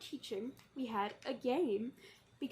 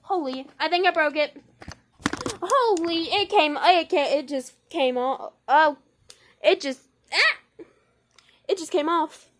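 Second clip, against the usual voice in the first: loud, higher, panicked disbelief; panicked shout; 3 semitones higher, 11 dB louder than usual. Real, not acted. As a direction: shout in shocked panic, half laughing. Camera stand broke.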